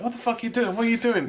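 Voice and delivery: high, shocked mock team; mock outrage. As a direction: imitate the stunned teammates. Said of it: "the whole team are like".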